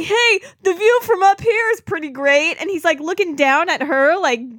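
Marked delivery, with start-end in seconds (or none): 0.0-2.5 leering Link. Retold or imitated.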